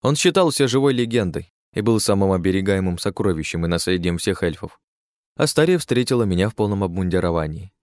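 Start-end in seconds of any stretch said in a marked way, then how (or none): none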